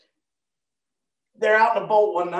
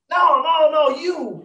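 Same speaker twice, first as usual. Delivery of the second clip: higher, louder pressing accusation; insistent accuser; 10 semitones higher, 4 dB louder than usual. Acted. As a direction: point him out, pushing hard. Accuser in the courtyard.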